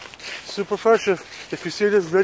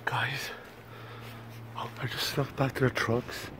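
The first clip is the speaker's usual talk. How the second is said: hushed whisper; 4 semitones lower, 10 dB quieter than usual. Real, not acted. Sneaking back to the trucks.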